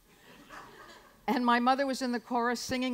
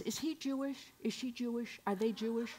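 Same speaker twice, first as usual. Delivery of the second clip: hushed; 8 dB quieter than usual. Retold or imitated. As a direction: murmur it like a secret. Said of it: "my mother would talk like this".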